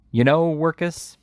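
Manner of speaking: high, slow, sneering fake sympathy; jeering mock pity. A narrator's reading. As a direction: pity him just to wound. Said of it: "jeering tone of affected pity".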